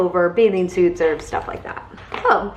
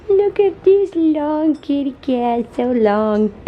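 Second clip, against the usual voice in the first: slow, high cooing; 5 semitones higher, 3 dB louder, much slower than usual. Real, not acted. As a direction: coo over the long cat. Cooing at the cat.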